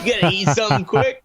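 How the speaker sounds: mock disbelief. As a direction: echo it back in disbelief. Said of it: "repeats line 79 mockingly".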